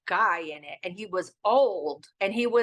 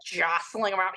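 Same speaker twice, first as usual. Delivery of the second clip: usual speaking voice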